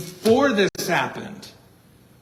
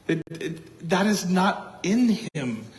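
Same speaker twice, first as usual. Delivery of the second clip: ordinary delivery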